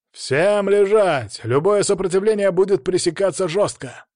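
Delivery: loud, high police order with the first words stretched out; loudspeaker command. A narrator's reading. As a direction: bellow orders to the whole crowd. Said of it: "Оглушительный голос по громкоговорителю". (громкий приказ как по громкоговорителю)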